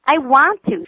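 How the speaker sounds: put-on high, loud, eager child's cry. A narrator's reading. Daughter flinging herself on family, crying out eagerly. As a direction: fling it out joyfully. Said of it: "she cries".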